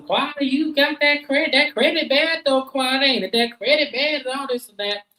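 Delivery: high, mock shrill hollering about bad credit; Simone hollering. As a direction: pitch it way up, needling her. Simone hollering, voiced in recap.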